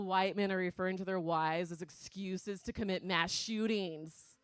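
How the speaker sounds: ordinary talk, nothing marked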